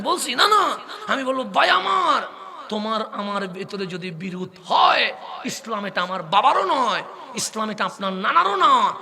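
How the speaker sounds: high, loud, drawn-out sermon cry; preaching at full force. Real, not acted. Preacher rousing the congregation at a mahfil, full-force sermon cry. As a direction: preach it out to the whole crowd.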